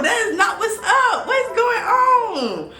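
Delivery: high, disbelieving yelp with a laugh; shocked outcry. Real, not acted. Venting to viewers about a date, shocked outcry.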